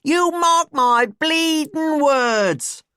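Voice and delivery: high, loud mock cockney; exaggerated cockney proclamation; hammed-up cockney. A narrator's reading. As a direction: lay the cockney on thick. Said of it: "common or garden English accent".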